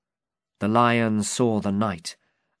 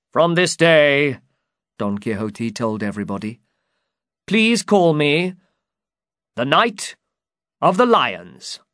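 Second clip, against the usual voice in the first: grand proclamation; 4 semitones higher, 5 dB louder than usual. Acted.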